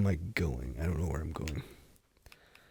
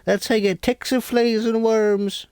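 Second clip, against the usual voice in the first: much higher and louder, a put-on cranky old-timer voice; shrill mock old-timer warning; 13 semitones higher, 6 dB louder than usual. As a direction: shout the warning like a half-crazed hermit. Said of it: "repeats louder in a put-on voice".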